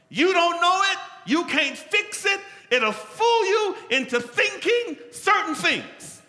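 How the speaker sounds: loud, high, slowed shouting sermon; preaching at full force. Acted. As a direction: drive the point home at full force.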